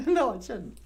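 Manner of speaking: high puppet bonjour. Acted. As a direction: squeak it out like a bubbly puppet.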